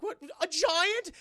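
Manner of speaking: high, loud mock disbelief; shocked exclamation. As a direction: burst out in imitated shock. Man shocked, retold.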